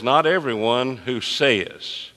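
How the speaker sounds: slow read leaning on "says"; teacher's slow underlining read; stress on says. Shown as a demonstration. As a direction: draw it out so "says" stands out. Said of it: "circle the word says there".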